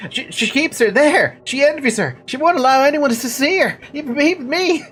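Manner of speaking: put-on high-pitched, timid stuttering villager with an accent; high, halting accented fun voice; put-on accented character voice. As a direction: play an apologetic, lovestruck simpleton in a high voice. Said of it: "it's a fun voice".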